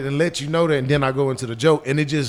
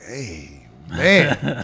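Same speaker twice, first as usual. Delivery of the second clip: deep voice; 5 semitones lower, 4 dB louder than usual. Retold or imitated. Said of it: "you looking at that check like".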